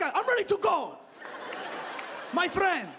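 put-on very high, accented shout; yelling Persian customer. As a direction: yell at the waiter in a mock Persian voice. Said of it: "They just yell at you".

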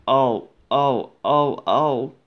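a hint of complaining truck voices; crying trucks. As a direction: wail like bumped little trucks. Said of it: "making them cry".

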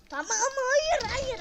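high, loud, slow anguished no; frustrated yell. Real, not acted. Gamer wailing loudly at a setback. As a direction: wail it out.

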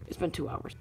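whispered grumble. Acted. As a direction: whisper it under your breath. Quiet repeat of the complaint.

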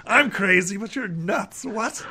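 high, incredulous crank; disbelieving, high-pitched character outburst; not-quite-sane alchemist. Acted. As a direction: play an unhinged alchemist who is baffled. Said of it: "alchemist who's not quite sane".

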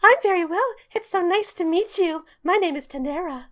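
high, bright fairy voice; squeaky little fairy greeting; tiny pixie voice. Acted.

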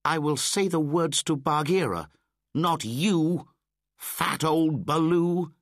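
put-on high, loud, cross child; sulky boy snapping at the old bear. A narrator's reading. Mowgli crossly refuses Baloo, high and loud. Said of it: "he said crossly".

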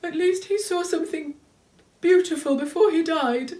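high, shaky, near tears; trembling voice. Acted. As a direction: let the voice tremble. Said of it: "said Moomintroll in a trembling voice".

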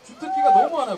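high, loud hype-man voice; excited hype. Real, not acted. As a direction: hype it up big. Host hyping member's talents.

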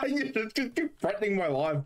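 high-pitched, laughing protest. Real, not acted. Friend reacting to a threat in a game, laughing. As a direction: laugh through the words, pitched well up.

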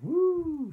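high, loud held hoot; long storybook ghost call; ghostly woo. A narrator's reading. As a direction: quote the ghost noise with a wail. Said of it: "I suppose it said".

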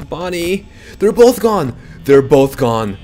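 high, loud panicked cry; real panic as both monsters vanish from view; panicked shout. Real, not acted. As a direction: yell it out in real alarm. Animatronics vanish, panicked calling.

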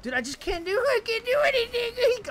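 high, loud whining complaint; exasperated wail. Real, not acted. Gamer who can't do anything, exasperated wail. Despairing at own play.

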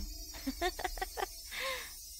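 high, soft creepy giggle; soft unsettling girl's giggle; eerie soft giggle. Acted. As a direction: giggle quietly like something's wrong. Girl giggles eerily.

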